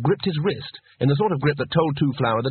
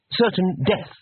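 higher-pitched, helpful correction; 7 semitones higher, slower than usual. A narrator's reading. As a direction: offer the correction obligingly.

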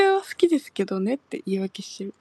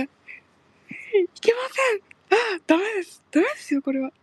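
much higher, flustered protesting cries; flustered teacher; 9 semitones higher, faster than usual. Retold or imitated. Teasing how the flustered teacher reacts, high flustered protest. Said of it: "って言ってる".

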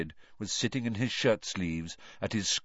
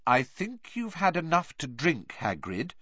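firm; 8 semitones higher than usual. A narrator's reading. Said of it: "said Hermione firmly".

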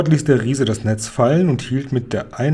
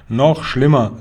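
louder, emphatic reply; exclaiming; 5 dB louder than usual. A narrator's reading. Giant warns Sophiechen about the nightmare, exclaiming. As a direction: call it out with alarm. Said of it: "rief der Guri".

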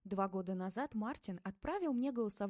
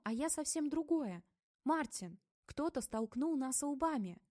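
ordinary delivery

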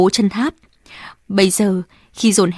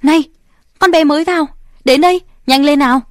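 higher, louder, fast call across the room; 5 semitones higher, 4 dB louder, faster than usual. Acted. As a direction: summon the new girl sharply. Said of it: "có tiếng gọi". (gọi to, gấp gáp)

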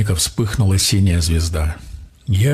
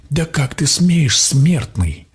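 higher, slow indignant outburst; indignant roar; 6 semitones higher, slower than usual. A narrator's reading. Water creature rebukes the mortal's demand, indignant roar. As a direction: draw out the outrage, pitched up. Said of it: "возмущённо заревел водяник".

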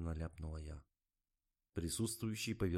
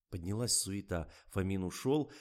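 ordinary delivery, nothing marked

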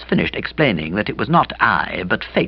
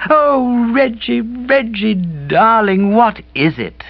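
slow, high, tender "Reggie, Reggie"; tender cry; 11 semitones higher, much slower than usual. A narrator's reading. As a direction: melt over him. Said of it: "she cried".

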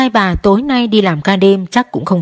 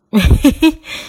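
coaxing chuckle; 7 semitones higher than usual. A narrator's reading. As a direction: chuckle kindly at the boy. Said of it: "Ông Đức cười dỗ thằng con".

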